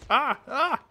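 two short high yelps; high-pitched cries of alarm; startled yelps. Real, not acted. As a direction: yelp twice in alarm mid-game. Startled during live gameplay.

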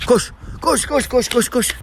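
high, excited run command; eager urging. Real, not acted. Owner excitedly urges his dog Alfa to run.

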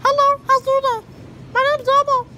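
high, loud Elmo squeak; bright puppet voice for strangers; playful Elmo impression to a shopper. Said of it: "Do I sound like Elmo?".

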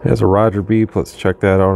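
normal talking voice

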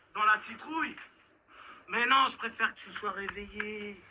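ordinary delivery

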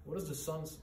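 ordinary talk

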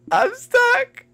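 high frightened gasp and cry; panicked, terrified; scared. Real, not acted. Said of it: "I'm so scared".

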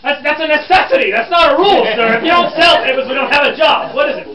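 high, loud, indignant scolding; exasperated correction. Acted. Reporter rejecting a student's wrong rule, exasperated. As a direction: knock the answer down, loud and impatient.

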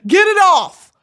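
loud, high, indignant rant; mock heckling. Fan booing [name] off the internet, loud mock outrage. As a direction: heckle him off the stage.

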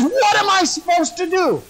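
high-pitched helpless prophet's protest; mock exasperation. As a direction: throw it out in hammed-up despair. Balaam in comic exasperation.

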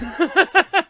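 high, loud burst of laughter. Real, not acted. Host laughing at his own five-star rating, loud.

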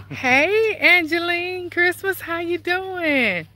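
high, loud, slow greeting across the street; calling out. Real, not acted. Calling out to neighbors passing on the walk, loud and drawn out. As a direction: stretch it out and call over to them.

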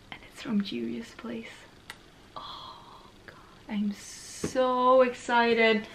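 hushed, very slow whisper; whispered, overwhelmed with excitement; excited whisper. Real, not acted. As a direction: breathe it out in awe. Discovering who sent the package.

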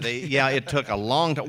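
ordinary talk, nothing marked

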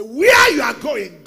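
loud, slow, booming retold shout. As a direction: thunder the words at him like a preacher. Pastor retells shouting at colleague.

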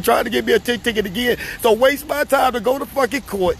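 high-pitched, heated protest of innocence; outraged, exasperated venting; real indignant anger. Real, not acted. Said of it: "I'm so mad".

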